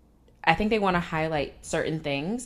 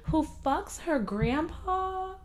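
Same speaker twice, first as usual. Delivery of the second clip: incredulous; 6 dB louder than usual. Real, not acted. Incredulous teasing question.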